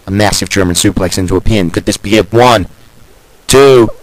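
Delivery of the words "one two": excited count. Acted. Counting the pin.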